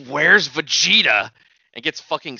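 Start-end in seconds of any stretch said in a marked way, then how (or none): 0.1-1.3 slow, loud demand; put-on demanding entrance; acted character demand. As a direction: call it out slowly and loudly in character. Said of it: "and he goes".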